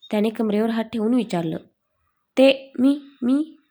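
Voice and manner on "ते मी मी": halting, stuck on मी; stammering. A narrator's reading. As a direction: fumble for words, caught out. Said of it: "Anju अडखळतच म्हणाली". (अडखळत बोलणे)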